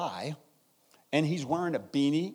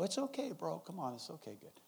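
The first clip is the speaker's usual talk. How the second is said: soothing; 4 semitones higher, 10 dB quieter than usual. Retold or imitated. Calming an angry man.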